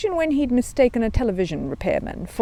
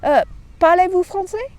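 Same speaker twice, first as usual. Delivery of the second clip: higher, careful schoolboy French; an awkward constable attempts French on a bear; hesitant French; 11 semitones higher, 5 dB louder than usual. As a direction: policeman trying out his French, unsure. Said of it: "trying again".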